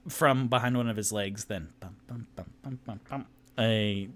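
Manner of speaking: normal talking voice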